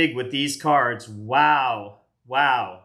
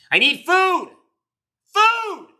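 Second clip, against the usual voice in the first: high comic shout for food; hammed-up cry of hunger; hungry yell; 6 dB louder, much slower than usual. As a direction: wail for food like a starving kid.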